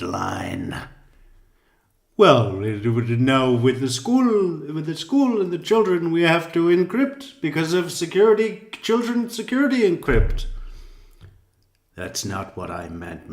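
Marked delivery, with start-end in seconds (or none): 2.2-10.4 louder, rising ever higher into a squeaky parody; lilting mockery building to a high squeak; mock sing-song. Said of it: "this fucking incessant sing-song voice".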